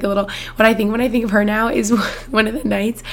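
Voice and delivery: ordinary talk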